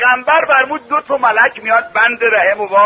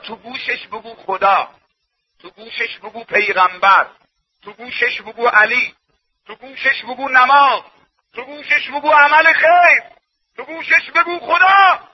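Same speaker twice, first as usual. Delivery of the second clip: starts restrained, climbs higher with each repeat; building exhortation; 3 semitones higher than usual. Acted. Preacher tells parents what to say to newborns, building exhortation. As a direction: build step by step to a ringing finish.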